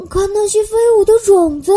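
put-on a boy shouting at seeds in the wind; excited shout. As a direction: burst out in delight. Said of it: "乔治也跟着喊起来".

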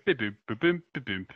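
beeping like a computer; computer beeps. Retold or imitated. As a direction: bip boop computer noises. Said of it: "trying to stop my computer going".

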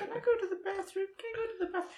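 put-on very high, squeaky little kid; pleading like a small child; whiny child voice. Quoting kids from Kindergarten Cop.

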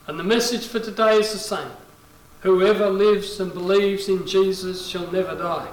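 ordinary talk, nothing marked

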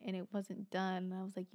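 usual speaking voice

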